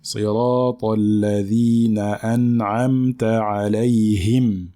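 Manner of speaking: measured chanted tajweed recitation; Quran recitation. Shown as a demonstration. Recites verse segment.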